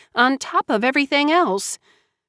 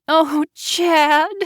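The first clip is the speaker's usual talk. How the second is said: anguished cry, pitched up high; 9 semitones higher than usual. A narrator's reading. A high anguished cry of her lover's name. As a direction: Joanna crying out alone in her room. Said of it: "she cried out loud".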